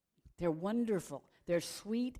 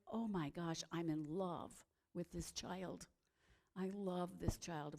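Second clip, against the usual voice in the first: softer, tender mother's joy; 7 dB quieter than usual. Acted. Mary marvelling at her baby, much softer and tender. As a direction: breathe it softly over a newborn.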